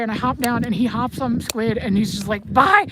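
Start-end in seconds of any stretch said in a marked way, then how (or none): 2.5-2.9 a bright shouted bye, much higher; quick loud bye. Retold or imitated. Brady leaving at a gallop, loud cheerful bye. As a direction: toss it over your shoulder as you ride off.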